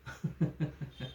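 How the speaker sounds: a faint, breathy giggle of menace; soft sinister chuckle. Acted. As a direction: laugh quietly to yourself, enjoying it. Menacing man toys with her. (हळूच खुनशी हसू)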